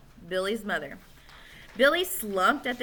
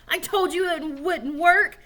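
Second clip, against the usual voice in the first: louder, higher, slow angry blame; angry scream; 8 semitones higher, 5 dB louder than usual. A narrator's reading. Alan screams at Joe over the failed trick. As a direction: shout it slowly at Joe. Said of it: "he screamed at Joe".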